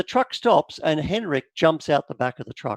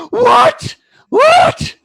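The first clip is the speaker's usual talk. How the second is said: very high, loud anguished shriek; mother shrieking in disbelief at her son, crying; crying scream; 10 dB louder than usual. Acted. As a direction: scream it through tears. Mother screams at son's news.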